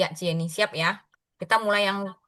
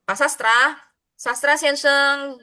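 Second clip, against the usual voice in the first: calling out; 6 semitones higher, slower than usual. Real, not acted. Calling a silent student.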